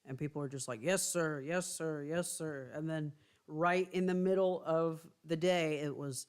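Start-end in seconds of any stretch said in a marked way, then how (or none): none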